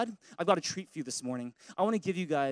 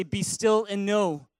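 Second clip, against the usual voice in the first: be still said slowly and loudly; loud slow response; 3 semitones higher, 6 dB louder, much slower than usual. Shown as a demonstration. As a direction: call it back slowly and loud. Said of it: "Everyone say".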